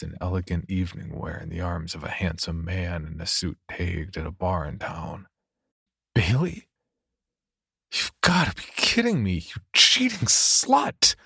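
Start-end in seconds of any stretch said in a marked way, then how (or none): 6.1-11.1 whimpering outburst. Acted. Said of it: "Tom whimpered".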